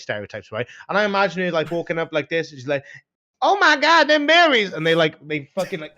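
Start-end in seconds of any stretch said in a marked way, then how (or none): 3.4-4.7 loud, high-pitched caricature of an American woman; mock American accent. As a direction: squeal it in a hammed-up American accent. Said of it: "Wait, what was that accent?".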